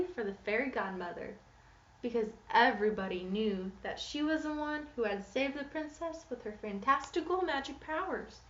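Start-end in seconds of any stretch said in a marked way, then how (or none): none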